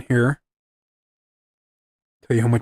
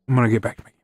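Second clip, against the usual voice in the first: ordinary talk, nothing marked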